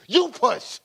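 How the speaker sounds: high, loud pushing coach; cheering on a pregnant woman, loud and high; mock labor coach. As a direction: shout it like a midwife urging her on.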